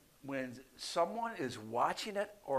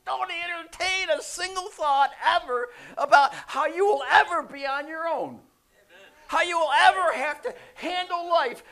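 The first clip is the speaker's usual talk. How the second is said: full-force high shout; emphatic preaching; 13 dB louder than usual. Acted. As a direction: shout the promise to drive out every doubt. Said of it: "he's emphatically saying that".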